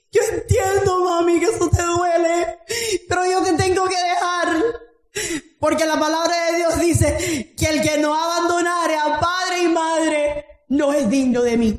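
strained, fervent plea retold to her mother; impassioned preaching. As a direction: plead with preacher's fervour.

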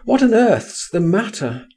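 high, urgent demand to know; alarmed cry of concern. A narrator's reading. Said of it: "I cried".

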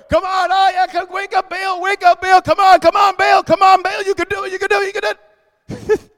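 high-pitched mock cheering-on. Preacher mocking the prophets begging Baal to wake, mocking. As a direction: egg on a useless god in a high, silly plea. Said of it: "He's gonna mock them. That's what I'm doing".

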